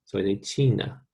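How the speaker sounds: ordinary talk, nothing marked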